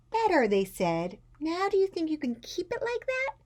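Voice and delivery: high, careful parental voice; coaxing parents. A narrator's reading. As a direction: encourage a child with strained patience. Parents coax Ruby, staying calm.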